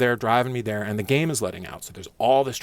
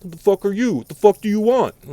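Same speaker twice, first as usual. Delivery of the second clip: much higher and louder mock yell; angry boss; 11 semitones higher, 10 dB louder than usual. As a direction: snap at the intruder like a rude boss. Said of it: "he's like".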